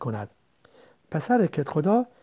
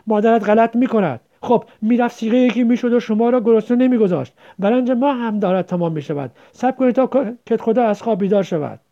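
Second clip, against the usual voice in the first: loud, higher-pitched scolding; scornful rebuke; 6 semitones higher, 7 dB louder than usual. Acted. Headman's wife refusing rice to children, scornful rebuke. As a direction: send them off with contempt.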